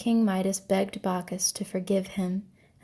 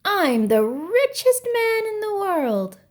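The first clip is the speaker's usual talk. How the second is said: much higher, louder gleeful shout; triumphant shout; 11 semitones higher, 5 dB louder than usual. A narrator's reading. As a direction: crow it to the sky. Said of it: "threw back his head and shouted".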